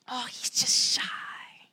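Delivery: slow whisper; whispered aside. Retold or imitated. Parent whispering a label about the child, slow whisper. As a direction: hush it behind the child's back.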